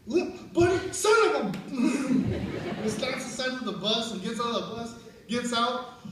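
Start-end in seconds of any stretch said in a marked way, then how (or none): 0.1-1.6 high, loud mock cursing; angry outburst. Leo cursing Buddy over the crushed bike, angry. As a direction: imitate an exasperated dad blowing up.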